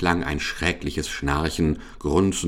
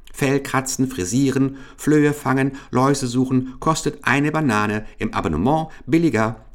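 high, sing-out vendor's list; little monkey hawker calling out; 7 semitones higher than usual. A narrator's reading. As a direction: cry your services like a small street seller. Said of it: "rief der eine".

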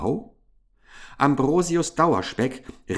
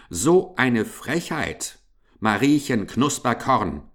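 plain reading voice

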